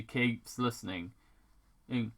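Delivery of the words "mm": plain reading voice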